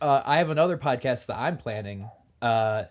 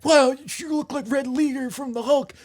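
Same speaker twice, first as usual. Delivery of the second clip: put-on loud, high Southern accent at the start; hammed-up Southern character voice; 5 semitones higher, 5 dB louder than usual. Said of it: "I'm still doing this in a Southern accent".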